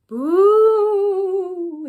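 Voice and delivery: high, loud drawn-out ghost wail; ghostly moan. Acted. Ghost twirling around the ghoul, high loud moan. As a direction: moan it long like a haunting ghost. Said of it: "he moaned".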